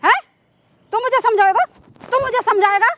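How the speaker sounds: outraged mother's tirade; scornful, indignant scolding; angry scolding. Acted. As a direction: berate him with indignant questions. Acted mother dismisses the man.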